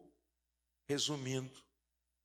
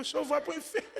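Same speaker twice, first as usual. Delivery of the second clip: put-on amused boy; 10 semitones higher, 7 dB louder, faster than usual. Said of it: "mandou eu pro inferno rindo".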